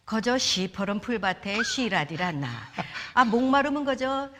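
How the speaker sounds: loud, playful North Korean dialect recitation; Pyongan dialect. Pretend. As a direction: recite the psalm in broad Pyongan dialect, hamming it up. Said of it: "평안도 버전". (평안도 사투리로 장난스럽게 시편 낭송)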